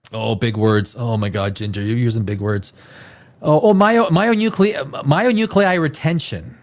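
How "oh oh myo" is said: usual speaking voice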